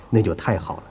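normal talking voice

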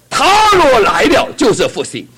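他若来了就是复兴 high, loud and slow with 他 pushed; loud proclamation. Shown as a demonstration. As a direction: cry it out slowly. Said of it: "耶稣来了就是复兴".

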